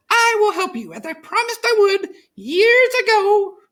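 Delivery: high, squeaky little mouse; a small grateful mouse voice; relieved little mouse squeak; tiny mouse. A narrator's reading.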